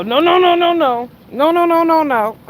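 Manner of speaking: high loud repeated no; mock loud protest. Imitating him at Jay Wilson, mock loud protest. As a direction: cry no over and over, hammed up. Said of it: "when he go".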